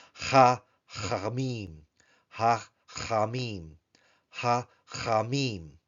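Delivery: "Ha, chamim" slowly, short "ha", pause, guttural "chamim"; hatef patach demo. Shown as a demonstration. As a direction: split off a rasped "Ha" before "chamim". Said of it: "so a hatef patak".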